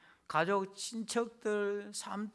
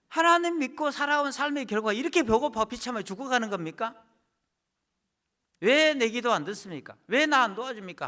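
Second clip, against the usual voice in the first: loud, higher grievance hurled at God; bitter complaint to God; 4 semitones higher, 5 dB louder than usual. Acted. Starving believer accusing God, loud and bitter.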